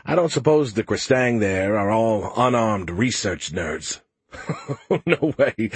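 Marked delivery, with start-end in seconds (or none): 4.3-5.7 laughing. Acted. Skippy laughing at Joe.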